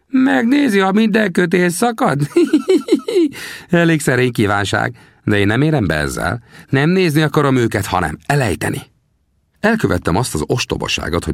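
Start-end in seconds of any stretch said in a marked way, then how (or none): 0.1-3.7 high mocking question breaking into laughter; teasing laugh. Sam mocks the greenhorn. 3.7-8.8 higher, teasing frontiersman; old trapper questions the young man. Acted. Sam's voice.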